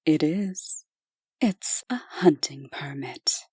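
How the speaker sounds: hushed, breathy whisper. Acted. Angela whispering to Gabrielle about the permit. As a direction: slip it to her in a whisper.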